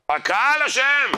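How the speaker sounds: high, slow, loud cry of "אשם"; loud outburst. Shown as a demonstration. Rabbi thunders blame at the congregation, loud and slow.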